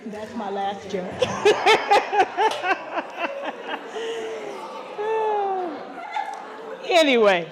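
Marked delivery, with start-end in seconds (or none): 1.2-6.8 long high laugh; hearty laugh. Real, not acted. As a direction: laugh long at your own joke. Laughing again.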